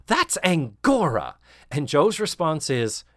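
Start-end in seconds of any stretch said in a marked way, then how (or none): 0.0-1.4 shocked gasp. Retold or imitated. She's like, gasps.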